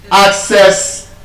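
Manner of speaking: "access" as ac-CESS; mistaken second-syllable stress. Retold or imitated. Teacher echoing the class's mistake, second-syllable stress on "access". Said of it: "you stress it on the second syllable".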